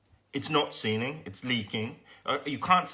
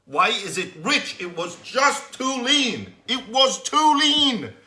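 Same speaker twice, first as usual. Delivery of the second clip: rising, louder disbelief, dragged out slowly; higher, slow, fed-up complaining; exasperated rant; 7 semitones higher, 7 dB louder, slower than usual. Retold or imitated. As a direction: vent at the engine like it betrayed you. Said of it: "I'm like".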